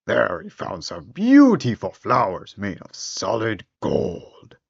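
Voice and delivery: ordinary delivery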